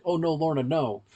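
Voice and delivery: ordinary talk, nothing marked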